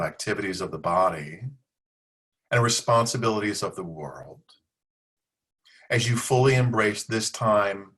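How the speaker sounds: unhurried meditation guidance with long pauses between phrases; guided yoga nidra. Real, not acted. Leads a yoga nidra practice.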